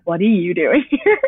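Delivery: "What are you doing here?" breaking into a laugh; giggly retold question; laughing quote. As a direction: laugh as you repeat the cops. Quoting the cops, laughing.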